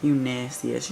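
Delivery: usual speaking voice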